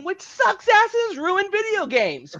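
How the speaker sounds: high, loud laughing tirade; laughing rant. Pretend. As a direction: rant while cracking up. Playful rant at a rival.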